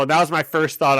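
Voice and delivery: ordinary talk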